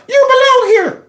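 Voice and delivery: squeaky high mock gush; mocking the politician. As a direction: parody her tearful promise, way up high. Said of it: "here she is talking about".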